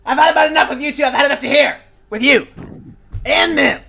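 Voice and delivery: put-on high, loud grumpy old gnome scolding; a crotchety gnome hollering at the adventurers for showing up late; cranky old gnome yelling. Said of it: "looks past you and goes".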